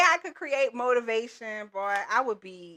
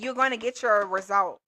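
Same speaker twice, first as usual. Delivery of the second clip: usual speaking voice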